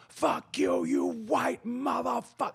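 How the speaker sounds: mock furious drummer. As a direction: imitate a big man cursing out a bandmate. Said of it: "in front of everybody goes".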